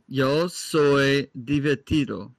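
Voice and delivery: slow, careful Spanish; Spanish demo. Shown as a demonstration. Tutor slowly teaches the Spanish line to a friend. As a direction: spell it out slowly. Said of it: "what is "I am funny" in Spanish".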